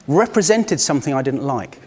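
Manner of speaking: usual speaking voice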